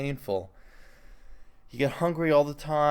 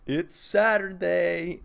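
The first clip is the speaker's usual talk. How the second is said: playful announcement; 6 semitones higher, 4 dB louder, slower than usual. Real, not acted. Podcast intro, playful announcement.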